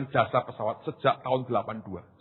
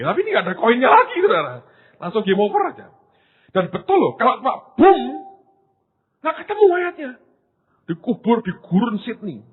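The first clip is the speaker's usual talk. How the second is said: rising excitement; 10 semitones higher, 8 dB louder than usual. Acted. Preacher builds to dramatic climax.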